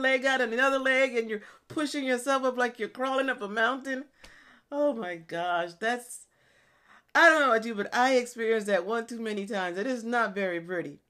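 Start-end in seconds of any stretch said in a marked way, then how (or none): none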